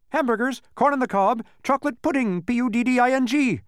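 high, loud bee voice; spelling bee character. A narrator's reading. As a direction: call it out high and pinched, spelling the last word. Spelling bee's banquet speech.

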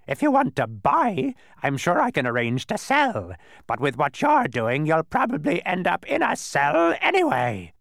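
high, loud bird squawk; quibbling bird. A narrator's reading. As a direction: twist their words in a screechy bird voice. Narrator voices the quibbling bird.

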